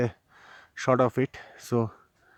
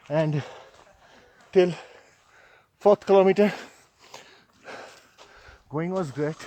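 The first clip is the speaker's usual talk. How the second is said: out of breath; 5 semitones higher than usual. Real, not acted. Still climbing the steep trail.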